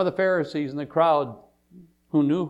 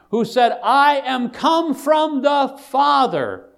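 raised pitch, building to loud proclamation; full-force preaching; 6 semitones higher, 7 dB louder than usual. Real, not acted. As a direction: declare it from the pulpit with mounting force.

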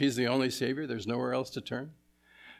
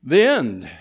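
Peter's announcement voiced higher and louder; Peter proclaiming; 8 semitones higher, 10 dB louder than usual. Preacher voicing Peter to the crowd, proclaiming loudly. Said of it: "And he says".

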